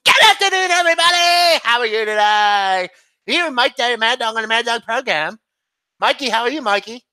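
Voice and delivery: high, yelled radio greeting; manic, high-pitched sports radio host; Mad Dog Russo. Acted. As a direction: shriek the show open, stretching the words. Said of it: "can I do Mad Dog Russo?".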